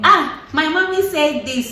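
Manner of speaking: a hint of a whining mommy's boy; mock mommy's boy. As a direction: whine like a grown man hiding behind mom. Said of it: "Mommy's boy".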